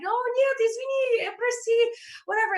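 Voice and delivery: high-pitched sheepish sorry; squeaky apologetic reply. Retold or imitated. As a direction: squeak out the apology like a scolded kid. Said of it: "I'm like".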